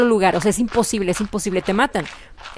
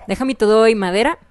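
ordinary delivery

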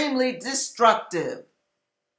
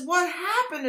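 stunned; 6 semitones higher, 8 dB quieter than usual. Retold or imitated. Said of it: "I'm saying".

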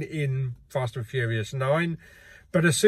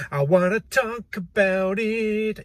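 higher and slow, each word stretched out; I wanna talk about it, sung out higher and slow; drawn-out insistence; 5 semitones higher, slower than usual. Acted. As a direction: drag it out like a whiny plea. Repeats the line for emphasis.

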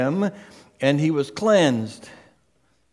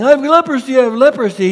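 high, loud repeated cry; acted public announcement, raised voice; mock announcing; 8 semitones higher, 6 dB louder, much faster than usual. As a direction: call it out urgently, pitched way up. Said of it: "So instead of announcing".

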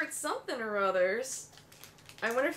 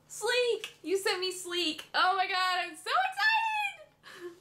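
high-pitched squeal of delight; excited screaming; 7 semitones higher than usual. Real, not acted. To Hannah who sent Sleek, screaming with excitement. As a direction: squeal upward, bursting with real excitement. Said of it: "I'm the one screaming this time".

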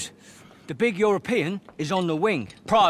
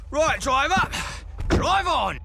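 calling order; 8 semitones higher than usual. Acted. Ordering the driver.

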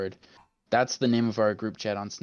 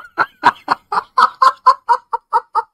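shrieking high laugh; loud high laughter; 15 dB louder than usual. Real, not acted. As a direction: burst out laughing hard. Big laugh after yelling.

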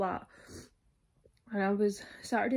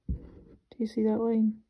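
whispering; slower than usual. Real, not acted. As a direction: hush it like a secret. Showing faint test line closely.

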